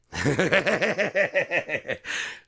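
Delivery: high, loud laugh burst; real laugh. Real, not acted. Laughing at own story.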